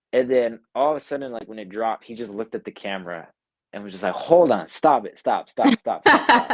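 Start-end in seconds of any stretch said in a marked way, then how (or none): 4.1-6.5 high, loud rapid-fire stops; urgent halt. Retold or imitated. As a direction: cut them off urgently, acting it out. Acting out his urgent halt to playback.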